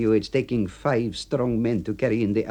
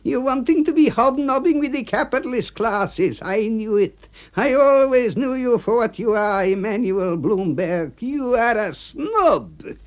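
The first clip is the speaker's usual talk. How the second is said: much higher, louder angry accusing; temper lost; 4 dB louder than usual. Acted. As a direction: rant at the snob. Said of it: "he's losing his temper".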